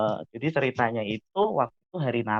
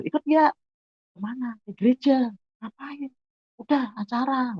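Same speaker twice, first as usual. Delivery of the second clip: high-pitched mock dialogue between friends; dialog ajakan teman; 13 semitones higher than usual. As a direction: replay a quick exchange, pitched well up. Dialog ajakan dua sahabat. (menirukan obrolan teman, nada tinggi)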